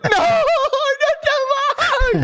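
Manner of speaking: very high, drawn-out wail; exaggerated high, slow begging; mock pleading wail. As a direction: beg like a caught kid, hammed up. Caught boy begs his brother.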